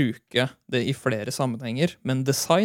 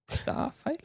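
whispered aside, higher and much softer; 14 semitones higher, 9 dB quieter than usual. Acted. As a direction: breathe the words out unvoiced. Repeats the phrase, whispered.

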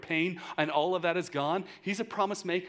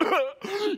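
mock baby cough; 7 dB louder than usual. Imitating his baby's cough.

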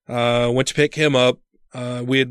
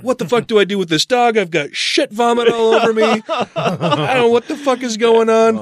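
higher panicky rant; freaking out; 8 semitones higher than usual. Retold or imitated. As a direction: babble in disgusted panic.

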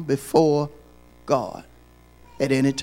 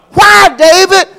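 high, loud call to David; loud rhetorical cry; 9 dB louder than usual. Acted. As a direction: shout the question. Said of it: "preacher asks David".

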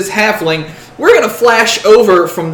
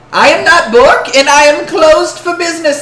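high, loud, indignant shopkeeper refusal; halfling shopkeeper; 10 semitones higher, 7 dB louder than usual. Acted. Halfling shopkeeper shutting out customers, high indignant voice. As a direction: snap shut for business, high and loud.

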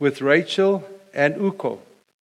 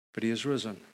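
softer, lower: but he is risen; quiet refrain; 5 semitones lower, 10 dB quieter, faster than usual. Acted. Preacher answering each suffering of Christ, soft and low. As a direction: let the answer fall soft and sure.

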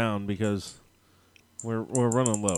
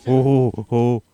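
excited hooting; 10 dB louder than usual. Real, not acted. Excited idea.

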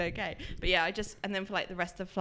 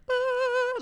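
sharp high gasp of alarm; a sudden high "Ah" as the senses tingle; startled gasp; 4 dB louder than usual. Retold or imitated. Describing spidey senses to a friend, a high startled gasp.

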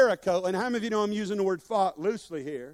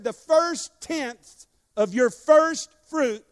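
very slow, louder, each "first" weighted; stressed God's words; 5 semitones higher, 6 dB louder, much slower than usual. Acted. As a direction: proclaim it slowly as God's command. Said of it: "Because God said".

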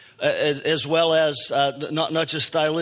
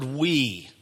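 "we" set apart and drawn out; stress on WE; 2 semitones lower than usual. Shown as a demonstration. Preacher singling out a word for the congregation, stress on "we". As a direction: land on "we" as the key word. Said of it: "I wanted to emphasize the word".

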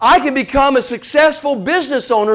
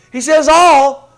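"all" slow, high and loud; stress on all; 6 semitones higher, 6 dB louder, slower than usual. Shown as a demonstration. Preacher stressing Paul's word, slow emphatic "all". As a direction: draw out "all" with full weight. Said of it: "to emphasize it".

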